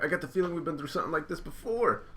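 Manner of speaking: lightly voiced anime heroine; Nami voice. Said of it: "Nami's like".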